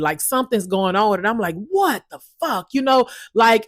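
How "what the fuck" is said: exasperated. Shown as a demonstration. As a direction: throw it out fed up, pitched way up. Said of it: "I gotta kinda be exasperated".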